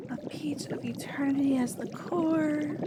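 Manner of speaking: unvoiced whisper to herself; whispered ingredient. Alchemist whispering a recipe step to herself, hushed. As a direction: breathe the ingredient under her breath.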